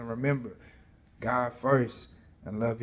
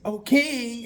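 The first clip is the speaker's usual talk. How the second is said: angels in back; 10 semitones higher, 7 dB louder than usual. Acted. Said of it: "they in the back in the corner like".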